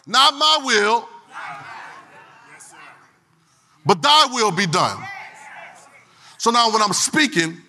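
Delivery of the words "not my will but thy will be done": slow, lifted prayer line; a hint of a solemn prayer. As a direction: pray it slowly, surrendering. Quoting Jesus's prayer.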